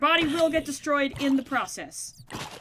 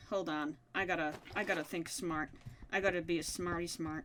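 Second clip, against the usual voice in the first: muttered to self; 8 semitones lower, 11 dB quieter than usual. Real, not acted.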